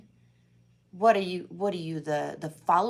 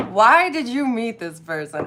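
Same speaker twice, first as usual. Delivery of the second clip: laughing; 2 semitones higher, 9 dB louder than usual. Real, not acted. Repeating question while laughing.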